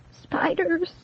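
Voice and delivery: high, soft, trembling word; quivering. A narrator's reading. Shaken girl murmuring the word, high and quivering. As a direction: let the fear shake through it. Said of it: "quivering uncontrollably".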